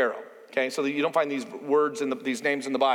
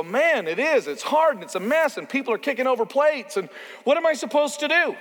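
higher-pitched, overwhelmed venting; mock exasperation; 7 semitones higher than usual. Reader reacting to a messy ministry, acted exasperation. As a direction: throw up a mock despairing question, pitch climbing.

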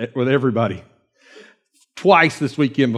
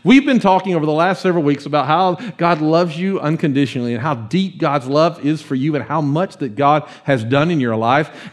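ordinary talk

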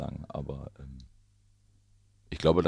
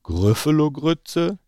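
much higher, louder, slow creature voice; startled Gruffalo; 10 semitones higher, 9 dB louder, much slower than usual. Acted. Gruffalo hearing it's the mouse's meal, shocked echo. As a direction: gasp it out as the shocked monster.

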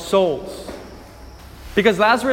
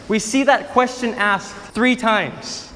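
normal talking voice

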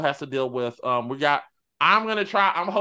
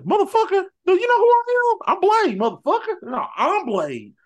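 high, loud angry Blade rant; Wesley Snipes as Blade; 7 dB louder than usual. Retold or imitated. Blade snapping at the new Blade, high and loud. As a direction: yell it like a furious Blade. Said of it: "He said".

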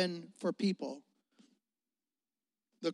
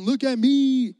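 loud, higher mock brag; hammed-up "look at me" boast; boastful quote; 5 semitones higher, 8 dB louder, slower than usual. Preacher mocking the boast he never made, loud. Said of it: "the first words out of my mouth wasn't".

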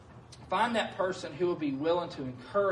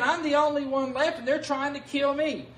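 higher and louder, self-pitying complaint; whiny Elijah; 7 semitones higher, 4 dB louder than usual. Retold or imitated. Preacher voicing Elijah's despairing complaint, pitched high and loud. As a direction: play Elijah moaning he's the only one left. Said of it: "he's saying".

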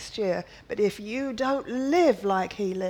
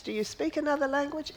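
ordinary talk, nothing marked

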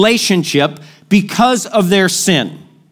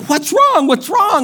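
worried men; 8 semitones higher than usual. Retold or imitated. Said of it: "the men are like".

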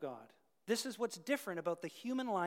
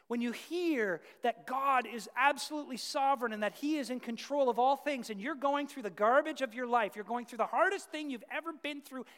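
louder and higher, driving the point home; impassioned pulpit build; full-force preaching; 5 semitones higher, 8 dB louder than usual. Real, not acted. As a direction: preach it with rising fire. Preaching climax.

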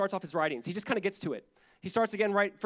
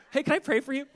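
mock eager offer; 7 semitones higher, 5 dB louder than usual. Churchgoers knocking on his door, mock eager. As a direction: pretend cheery doorstep greeting, higher and louder.

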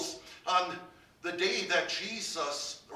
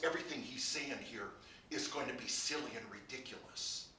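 usual speaking voice

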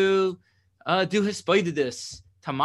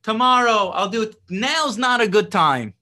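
much higher and louder brush-off; mock excuse; 5 semitones higher, 5 dB louder than usual. Mimicking someone dodging the work, high and loud. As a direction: brush the task off loudly, high-pitched. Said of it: "right, they say".

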